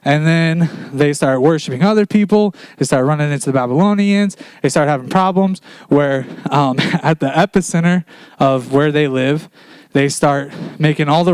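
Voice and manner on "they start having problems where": ordinary talk, nothing marked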